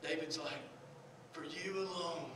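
soft, low, with long pauses; gentle, low-pitched preaching; hushed sermon close. Real, not acted. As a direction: bring it down to a quiet, prayerful murmur.

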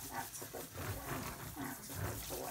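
whispered praise to the dog; hushed reassurance for an anxious dog. Real, not acted. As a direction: breathe the praise softly into his ear. Soothing dog during bath.

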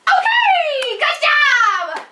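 هتاف عالٍ وحاد مع ضحكة؛ يصرخ فرحاً مشجعاً؛ يشجع كلبه بصرخة فرح عالية; excited cheer. Real, not acted. Dog catches toy, owner cheers.

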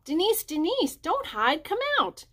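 high, carrying call across the hill. A narrator's reading. Children calling across the hillside for hidden Denise, high calling. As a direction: call a hiding friend out.

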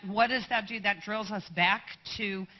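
ordinary talk

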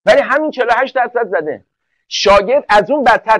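ordinary talk

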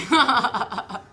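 laughing out loud at her own joke; a full, loud burst of laughter; hearty laugh. Real, not acted. Vlogger laughing at her own prayer story, loud.

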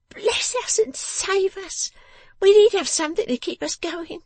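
very high-pitched, world-weary voice; sharp little Jenny. Acted. Jenny agrees to supper with Lizzie, high and put-upon.

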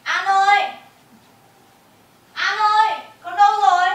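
mother calling for her missing daughter in rising alarm; frantic calling. Acted. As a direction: search desperately for the child. Mother searching, finds child collapsed.

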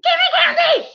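put-on high loud demanding child's yell; kid screaming. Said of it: "I scream".

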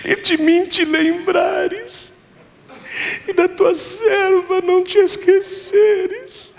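high, slow sobbing plea rising to a cry; crying prayer. Retold or imitated. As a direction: sob out the vow, almost shouting the last line. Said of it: "E ela chorava".

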